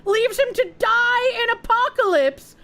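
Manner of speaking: hammed-up breaking-news anchor; mock news read. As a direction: announce it like a tabloid headline. Mock news headline about herself.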